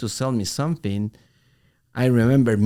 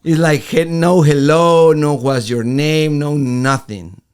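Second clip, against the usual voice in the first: loud; 4 semitones higher, 9 dB louder, slower than usual. Real, not acted. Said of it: "retelling the rude greeting".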